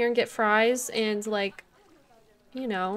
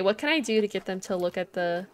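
usual speaking voice

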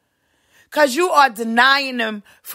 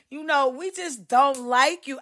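ordinary talk, nothing marked

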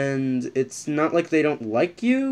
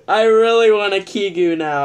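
high, loud whiny wish; longing whine; 5 semitones higher, 5 dB louder than usual. Real, not acted. Wanting a Kigu, whiny. Craving a costume.

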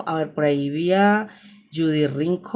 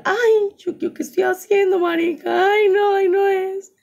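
flustered squeal; 3 dB louder than usual. Real, not acted. Flustered by the camera settings.

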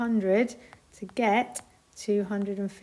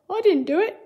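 wombat voice; 9 semitones higher, 7 dB louder than usual. A narrator's reading.

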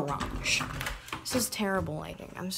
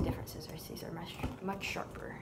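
soft whispered commentary; 2 semitones lower, 11 dB quieter, faster than usual. Real, not acted. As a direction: whisper as if not to disturb. Whispering while filming the task.

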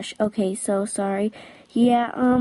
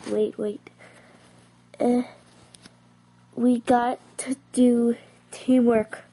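usual speaking voice

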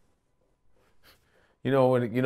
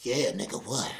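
mock girlfriend in bed; 4 semitones lower, 9 dB quieter than usual. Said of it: "she's like".

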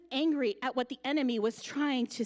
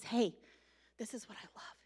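soft unvoiced whisper; 4 semitones lower, 7 dB quieter than usual. Martha whispering to Mary at the mourning, hushed. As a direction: breathe it into someone's ear. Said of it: "she whispers it in his ear".

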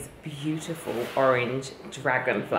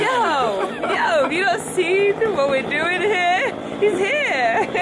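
high, incredulous "yo, yo, have you not seen"; mock disbelief, pitched way up; exasperated retort; 4 dB louder than usual. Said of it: "I'm like".

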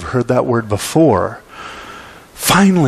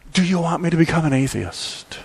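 understated, softer desperate question; subdued plea, without the cartoon outburst; quiet despair; 3 semitones higher, 4 dB quieter than usual. Despairing Christians cry out to God, subdued and serious. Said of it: "in their despair, they've cried out".

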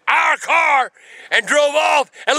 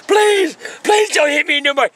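mock pleading; 4 semitones higher than usual. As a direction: wail please like a beaten man. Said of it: "Were you really?".

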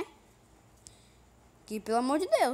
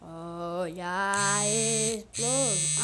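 very slow, low, stretched words; drawn-out call; 5 semitones lower, much slower than usual. Pretend. Gamer watches for the blast, slow low drawn-out call. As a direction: stretch each vowel right out. Announces another explosion.

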